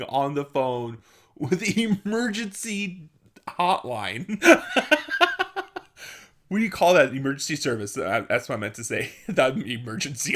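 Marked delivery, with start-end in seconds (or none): 4.5-5.8 squealing laugh at herself; helpless laugh; high giggle. Real, not acted. Laughing at the 911 call.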